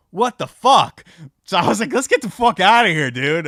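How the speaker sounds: loud, high, thrilled disbelief; excited yelling. Retold or imitated. Telling how he yelled after winning four hundred at the slots. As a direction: shout it like you just hit the jackpot. Jackpot win at slot machine.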